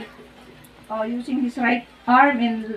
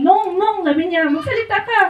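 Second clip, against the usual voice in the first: alarmed pleading; 7 semitones higher, 4 dB louder than usual. Retold or imitated. Lani alarmed, urging him to speak.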